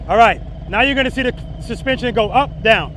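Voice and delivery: loud, high, called over the bike; shouting over engine. Real, not acted. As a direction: call it out over the engine noise. Demo ride, talking over engine.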